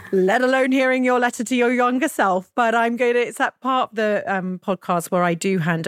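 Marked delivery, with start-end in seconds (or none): 0.0-2.5 higher, laughing through the words; emotional laugh. Real, not acted. Host to guest before her letter, laughing and emotional. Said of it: "I'm already feeling highly emotional".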